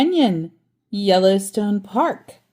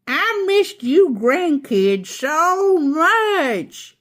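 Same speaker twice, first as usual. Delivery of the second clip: higher, louder, slow joyful exclaim; exclaiming grandpa; 10 semitones higher, 5 dB louder, much slower than usual. A narrator's reading. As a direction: call out happily to the grandkids. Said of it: "he exclaimed".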